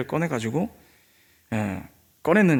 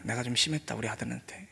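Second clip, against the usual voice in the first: murmured regret, softer and lower; mildly remorseful thought; 3 semitones lower, 10 dB quieter than usual. Retold or imitated. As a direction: think it aloud softly, sinking away. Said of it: "좀 미안한 생각". (미안한 듯 나직한 혼잣말)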